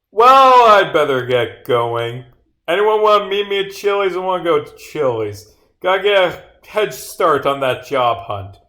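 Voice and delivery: big, loud, high swoop into a chipper exit; breezy goodbye. Acted. Character leaving the detectives to job hunt, breezy and loud. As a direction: announce your departure with showy cheer.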